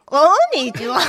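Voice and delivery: Hiromi's voice bleating like a goat; a bleating Hiromi hello; Hiromi doing a goat. Shown as a demonstration. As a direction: Hiromi imitating a goat, on request. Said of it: "ヤギの真似をしたヒロミさんで".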